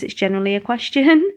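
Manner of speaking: "question" swung upward like a query; rising question intonation. Shown as a demonstration. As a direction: go up at the end on "question". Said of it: "if you go up at the end".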